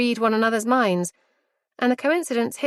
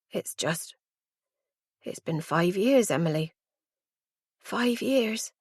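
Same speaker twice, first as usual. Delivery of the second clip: soft, slow and tearful; 5 dB quieter, slower than usual. A narrator's reading. Mum near tears telling her daughter, quiet and tearful. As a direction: hold back tears on the years. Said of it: "her eyes glistening with tears".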